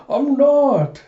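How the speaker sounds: high, loud mock outburst; indignant protest. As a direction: protest loudly, pitch leaping way up. [name] protests at being called nervous.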